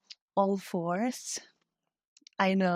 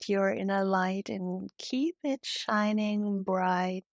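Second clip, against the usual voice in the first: guided meditation. Real, not acted. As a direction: guide them gently into breathing, unhurried. Leads a heart-centred yoga meditation.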